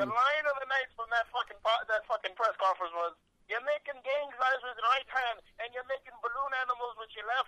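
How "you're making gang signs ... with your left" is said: higher-pitched mock Irish accent; lightly hammed-up Irish brogue, mocking; Conor McGregor Irish accent. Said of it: "I love [name]'s Irish accent".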